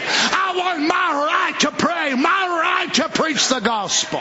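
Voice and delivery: high-pitched, impassioned pulpit delivery; rising, driving sermon cadence; fervent preaching. Acted. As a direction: plead and declare it like a revival preacher.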